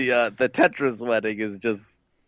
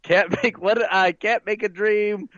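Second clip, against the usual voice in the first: high-pitched, hammed-up slogan; wedding planner character; 9 semitones higher than usual.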